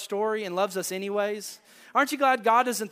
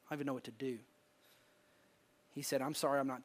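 mild grieving father; 5 semitones lower, 6 dB quieter than usual. Retold or imitated. Grieving father voiced quietly.

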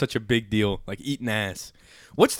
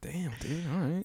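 impressed murmur; 3 semitones higher, 13 dB quieter than usual. Retold or imitated. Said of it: "I was like".

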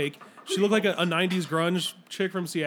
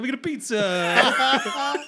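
put-on high, drawn-out joke Italian accent; mock Italian accent; 5 semitones higher, 4 dB louder, much slower than usual. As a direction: copy the hammy Italian pizza order, slowly.

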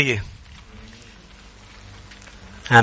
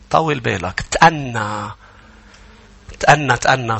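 calming reassurance; 3 semitones lower than usual. Real, not acted. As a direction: drop down low and soothe them. Calming listeners after climax.

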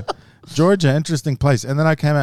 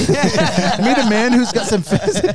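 put-on slow, higher, laughing New Zealand accent; mock New Zealand accent; 11 semitones higher, 4 dB louder, slower than usual. Joking with podcast friends, a laughing New Zealand accent. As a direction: ham up a New Zealand announcer through laughter. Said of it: "New Zealand accent".

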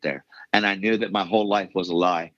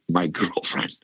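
laughing whisper; 3 semitones lower, slower than usual. Real, not acted. Laughs over Dan discipling girlfriend.

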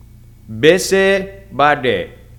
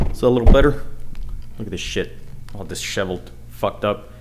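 normal talking voice

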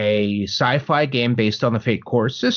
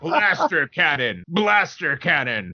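slow, higher game attack catchphrase; weapon call-out; 4 semitones higher, slower than usual. Shown as a demonstration. Player naming the weapon he uses, drawn-out game call-out. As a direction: repeat it identically like a game sample. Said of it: "I shout it out".

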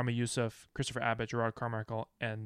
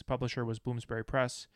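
ordinary delivery

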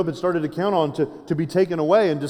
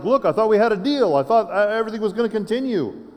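higher-pitched, protesting [name]; mildly freaked out; 6 semitones higher than usual. Acted. [name] complaining to God about a deal, freaked-out protest. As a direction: protest in raised pitch, as if blindsided. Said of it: "And I kind of freaked out".

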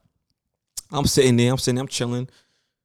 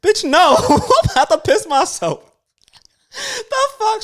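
mock outrage; slower than usual. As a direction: yelp it out, half laughing, as if about to wet yourself. Retold reaction to nurse's question.

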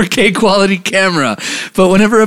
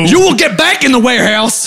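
high, raised-pitch ordering dad; mock angry father; 9 semitones higher than usual. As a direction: order the kid back to work, hammed-up. Co-host plays the angry father.

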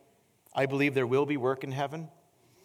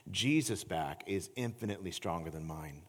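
normal talking voice

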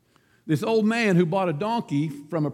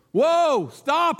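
higher, louder, yelling at a runaway donkey; panicked cry; 7 semitones higher, 4 dB louder than usual. Acted. Rider heading for a cliff yells at his donkey, panicked cry. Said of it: "he cried".

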